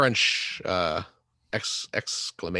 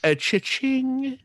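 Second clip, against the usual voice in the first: cha-ching; 13 semitones higher, slower than usual. Pretend. Mock cash register cheer.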